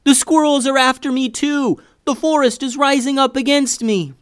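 high, loud frantic cry; panicked shouting. A narrator's reading. Boy fleeing snake and squirrel, high panicked shouting. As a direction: yell like the whole forest is coming for you. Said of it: "clearly panicked".

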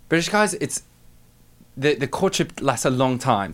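careful imitation of a posh British actress; British accent attempt. Shown as a demonstration. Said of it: "A, a British accent?".

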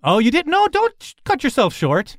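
put-on high, animated old lady voice; encouraging elderly woman. Elderly woman bucking up a modest wizard, high and encouraging. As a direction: lift the pitch to coax.

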